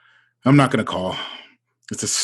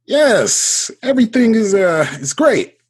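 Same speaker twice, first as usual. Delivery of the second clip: flustered excitement; 8 semitones higher, 5 dB louder than usual. Acted. Flustered when his crush calls.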